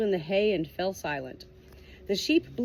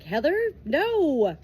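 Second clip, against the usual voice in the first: trying then rejecting; 7 semitones higher, 5 dB louder, slower than usual. Acted. Cloud tries then rejects.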